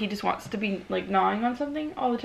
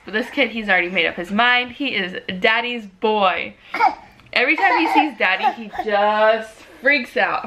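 normal talking voice

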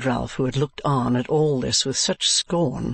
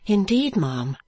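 high, timid little reply; 7 semitones higher, slower than usual. A narrator's reading. Girl answering her prospective employer, timidly. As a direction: start high and drop meekly. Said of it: "replied Kate timidly".

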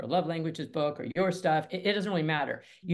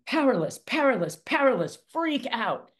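panicky amygdala voice; 7 semitones higher, 5 dB louder than usual. Pretend.

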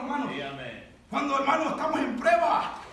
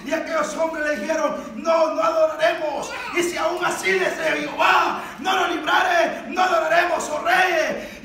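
loud, raised preaching delivery; full-force preaching; 4 semitones higher, 6 dB louder than usual. Acted. Preacher retells the men's refusal to the king, full-force preaching.

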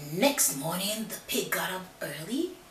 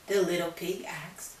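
usual speaking voice

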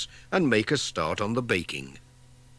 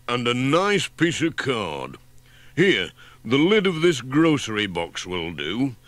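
a practical handyman with a plan; Ted the handyman; 3 semitones higher, 3 dB louder than usual. Acted. Ted explains gluing.

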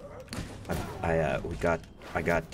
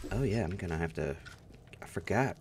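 ordinary talk, nothing marked

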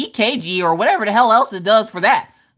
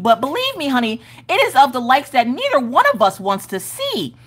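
sassy comeback; 7 semitones higher than usual. Retold or imitated. Talking back to the rude receptionist, sassy and higher. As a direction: put her in her place with attitude.

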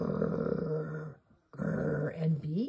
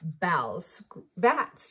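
ordinary delivery

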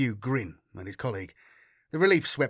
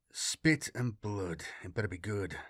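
grumbling low; 4 semitones lower, 7 dB quieter than usual. Acted. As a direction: grumble it under your breath, very low. Captain grumbling half asleep.

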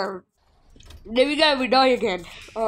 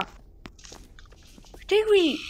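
ordinary talk, nothing marked

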